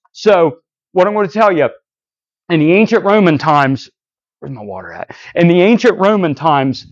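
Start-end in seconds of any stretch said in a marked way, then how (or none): 4.4-5.1 low, hushed aside to oneself; offhand and much softer; quiet aside. Real, not acted. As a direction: drop right down, almost under your breath. Aside while looking for water.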